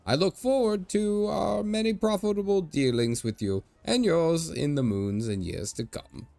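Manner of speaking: put-on jolly old Irish merchant showing off; Irish leprechaun merchant. Old merchant boasting to strangers in a hammed-up Irish brogue.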